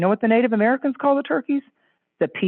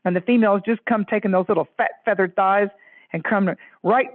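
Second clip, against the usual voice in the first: ordinary talk, nothing marked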